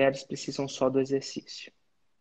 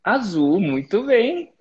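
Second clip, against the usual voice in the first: loud, higher, delighted teacher's "well done"; excited praise; 5 semitones higher, 8 dB louder, much slower than usual. Real, not acted. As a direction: reward the right answer with a burst of enthusiasm. Mentor praises student's right answer. (elogio animado, mais alto e mais agudo)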